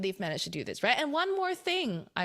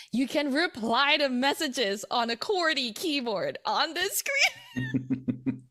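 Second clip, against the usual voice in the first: high, laughing disbelief; incredulous; 5 semitones higher, 5 dB louder, slower than usual. Real, not acted. Reviewer marveling at a tiny phone screen, incredulous. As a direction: climb into giddy, incredulous amazement. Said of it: "I know I'm sounding a little incredulous".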